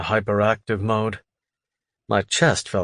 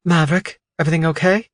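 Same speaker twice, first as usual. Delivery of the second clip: higher, gentle woman's voice; 7 semitones higher, 4 dB louder, faster than usual. Acted. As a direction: speak as the concerned wife. Wife calls Maverick on phone.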